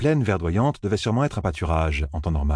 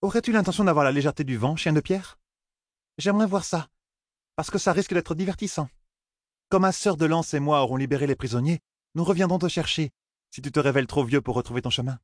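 higher, quick mocking warrior woman; amused teasing; 9 semitones higher than usual. A narrator's reading. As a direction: tease him playfully. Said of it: "d'un air amusé".

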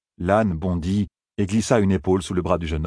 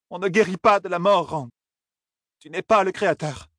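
a very high, firm woman's voice; Moiraine's firm reply; 12 semitones higher than usual. A narrator's reading. Moiraine tells Rand no one heals death, very high and firm. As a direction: set him straight, firmly.

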